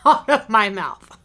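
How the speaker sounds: normal talking voice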